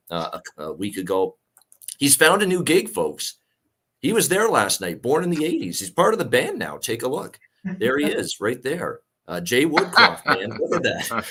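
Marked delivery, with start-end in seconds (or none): 2.0-11.2 playfully high, excited announcing; hammed-up big reveal to the audience; mock announcer reveal. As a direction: present the joke like breaking news. Joking reveal of coach lookalike.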